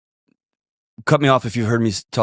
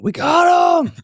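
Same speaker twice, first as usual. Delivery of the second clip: high, loud celebratory holler; excited yell; 5 dB louder, slower than usual. Retold or imitated. Manager yelling good news into the phone, excited high holler. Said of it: "starts yelling into the phone".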